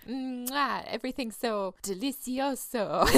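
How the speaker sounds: kiss sound, then a hammed-up delicioso; mock chef's kiss. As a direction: play the fancy foodie. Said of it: "the kissy sound".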